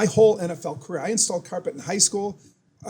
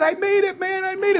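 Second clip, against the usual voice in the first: much higher, thrilled cry; excited; 13 semitones higher, 3 dB louder than usual. Retold or imitated. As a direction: burst out with pride. Answering McMahon, overjoyed.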